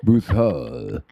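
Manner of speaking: loud, tongueless mock-French Broussard; bad French like a vampire missing his tongue; botched French accent. Shown as a demonstration. Joking that his French sounds like someone took his tongue, French accent. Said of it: "like a vampire bit his own tongue out".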